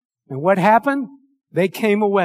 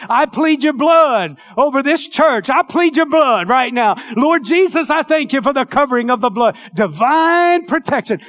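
higher, louder pleading prayer; fervent prayer; 5 semitones higher, 4 dB louder than usual. Acted. Pastor pleading the blood over the church, fervent. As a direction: cry out to the Lord for the church.

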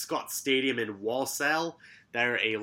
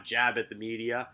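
ordinary talk

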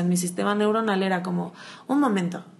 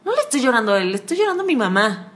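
put-on higher, childlike realization; a touch of little girl; 6 semitones higher than usual. Recalling hearing herself cry over an ex. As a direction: say it like a small girl discovering herself. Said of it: "y yo".

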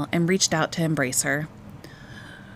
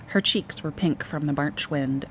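ordinary delivery

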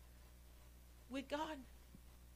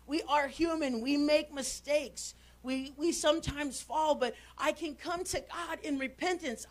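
usual speaking voice

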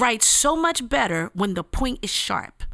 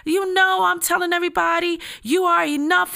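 much higher, indignant retold outburst; offended inner rant; 8 semitones higher than usual. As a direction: replay your own hurt, worked-up reaction. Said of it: "I felt some kind of way".

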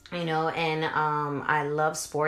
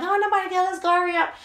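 high, loud hurry-up dismissal; impatient brush-off; 6 dB louder, much faster than usual. Retold or imitated. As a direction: shoo everyone out the door, pitched up. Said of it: "And I'm like".

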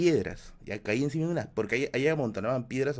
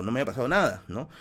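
ordinary talk

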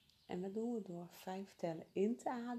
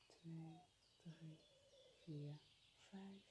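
much softer, near-whispered counting; 3 semitones lower, 17 dB quieter, much slower than usual. Acted. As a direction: count barely above a whisper. Counting breaths very softly.

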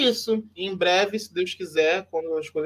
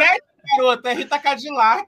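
loud, high laughing correction; excited correction; 8 semitones higher, 8 dB louder than usual. Real, not acted. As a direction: shout the correction through laughter. Correcting friend, laughing loudly.

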